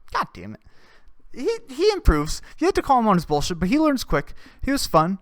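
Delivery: ordinary talk, nothing marked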